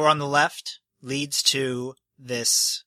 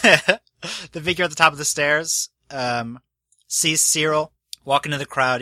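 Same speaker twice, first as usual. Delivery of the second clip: usual speaking voice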